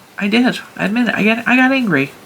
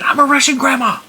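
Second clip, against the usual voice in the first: higher, emphatic retold announcement; insistent repeat; 8 semitones higher than usual. Repeating to her puzzled husband, pitched up and insistent. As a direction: say it again, pitched up to make him get it. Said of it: "I said".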